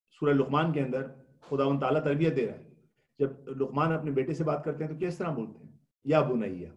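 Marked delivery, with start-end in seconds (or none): 6.2-6.7 soft, lower loving address to a son; a father's fond, gentle call. Shown as a demonstration. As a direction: call your son tenderly. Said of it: "بنیا ایک بہت پیار بھرا لہجہ".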